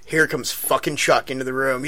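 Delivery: normal talking voice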